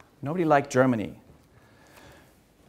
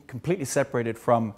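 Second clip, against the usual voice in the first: usual speaking voice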